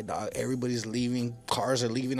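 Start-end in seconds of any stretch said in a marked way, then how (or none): none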